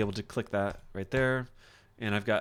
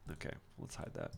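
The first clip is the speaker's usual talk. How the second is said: soft conspiratorial whisper; hushed aside to the viewers; 15 dB quieter, faster than usual. Real, not acted. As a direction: whisper it like a little secret. Playfully hiding a secret.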